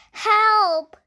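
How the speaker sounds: very high, loud, panicked cry for help; frightened cry for help. Acted. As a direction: scream for help, stuck up in a tree. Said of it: "she said".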